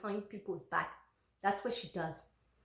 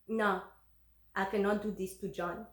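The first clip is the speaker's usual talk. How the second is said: normal talking voice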